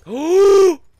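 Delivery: loud, high, whooping gasp; frightened gasp. Real, not acted. Player hiding from intruder, frightened gasp. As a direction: gasp in shock.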